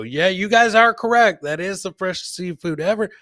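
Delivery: ordinary talk, nothing marked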